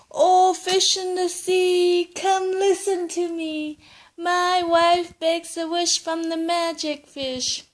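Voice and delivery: high, loud, slow chant to the fish; calling out the rhyme. Acted. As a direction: call across the sea in a singsong. Grandpa calls the fish rhyme.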